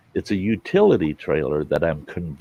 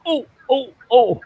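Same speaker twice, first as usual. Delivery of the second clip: very high, loud imitated ohs; eager imitation; 6 dB louder than usual. Neighbor spotting a chance for a favor, excited. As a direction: mimic an eager neighbor piping up.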